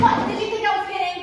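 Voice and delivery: yelling. Acted. Georgie yells at Andrew over the phone call, furious. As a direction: yell it at him, outraged.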